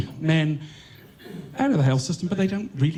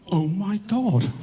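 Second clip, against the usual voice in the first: slow, higher stunned gasp of words; stunned disbelief; 5 semitones higher, slower than usual. Retold or imitated. Retelling the funder's Zoom call, slow stunned disbelief. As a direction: let it sink in slowly, amazed.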